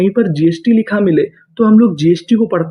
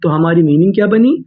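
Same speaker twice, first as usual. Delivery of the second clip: normal talking voice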